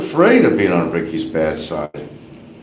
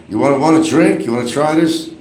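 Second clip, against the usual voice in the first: a hint of Barry, higher; lightly voiced drunk offer; imitating Barry offering residents a drink, pitched up; mock offer; 7 semitones higher than usual. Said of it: "he asked".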